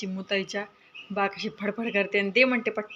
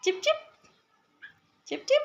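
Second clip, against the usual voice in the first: chirping bird call. Acted. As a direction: squeak like a mother sparrow calling her chick. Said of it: "आणि चिपचिप असा आवाज काढला".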